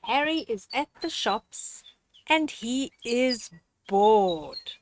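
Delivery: ordinary delivery, nothing marked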